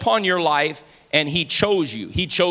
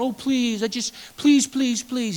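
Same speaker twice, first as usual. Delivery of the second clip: hammed-up pleading; a whiny mock plea, please after please; mock begging God; 4 semitones higher than usual. Pastor mocks a God who begs, with whiny pleading. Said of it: "is not going, ... begging you".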